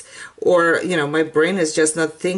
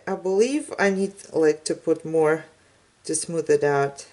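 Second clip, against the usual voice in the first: ordinary talk, nothing marked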